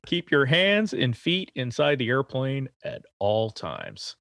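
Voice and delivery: slow, mock ride-safety announcement; measured, put-on PA spiel; Disneyland-style announcer. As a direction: announce it like a theme park ride. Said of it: "You been working at Disneyland?".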